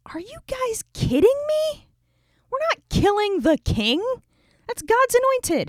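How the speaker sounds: high, incredulous squeak of a scolding; shocked, high-pitched "are you kidding me"; exasperated disbelief. Retold or imitated. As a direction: scold them, appalled they'd even suggest it. David scolds his men.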